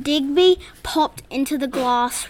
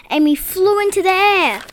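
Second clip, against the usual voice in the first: plain reading voice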